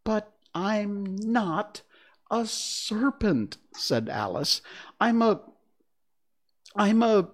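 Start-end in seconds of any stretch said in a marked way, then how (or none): 0.0-3.6 high, slow emphatic protest; high-pitched, insistent little-girl denial. A narrator's reading. Alice protests to the pigeon. 5.0-7.3 high, loud halting stammer; high-pitched, hesitant false starts; faltering. A narrator's reading. Alice falters for an answer.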